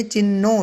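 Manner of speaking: चिन्नो with a long doubled n; double n. Shown as a demonstration. Teacher stressing the double n for students. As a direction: hold the n in चिन्नो, chin-no. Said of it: "double न है यहाँ पर".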